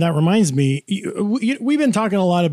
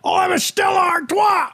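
high mock Dude; Jeff Bridges voice; 12 semitones higher than usual. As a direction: whine it like the Dude. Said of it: "you're doing Jeff Bridges there".

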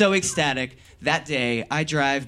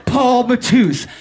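loud drawn-out shout of the name; bullhorn name call; 7 semitones higher, 7 dB louder, slower than usual. Retold or imitated. Calling his name out from a car with a bullhorn. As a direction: blast the name like through a bullhorn. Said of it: "So I double down".

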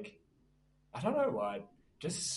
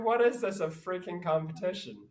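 exasperated; 6 semitones higher, 4 dB louder than usual. Retold or imitated. Retold outrage at sick friend.

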